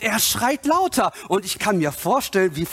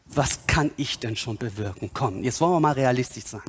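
low, deflated sceptic's voice; acted self-doubt, pitched down; doubting realist; 6 semitones lower, 3 dB quieter than usual. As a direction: talk yourself down, matter-of-fact. Acts out a doubting believer.

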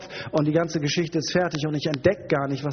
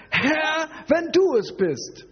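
high, drawn-out call; hammed-up calling out; 13 semitones higher, 3 dB louder, slower than usual. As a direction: shout it slowly across the water, hammed up. Acts Petrus calling to Jesus. (übertrieben gedehntes, hohes Rufen)

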